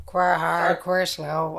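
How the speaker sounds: lightly voiced Wexford local; "quar" as "kwar", in a Wexford accent. Shown as a demonstration. Showing Wexford slang to the co-host, Wexford accent. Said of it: "if you're in Wexford, you just say".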